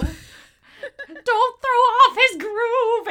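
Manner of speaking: very high, loud, drawn-out mock plea; frantic old man. Old man climbing on Pacha, high frantic mock wail.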